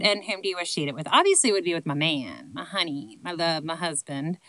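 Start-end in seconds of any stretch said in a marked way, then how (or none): none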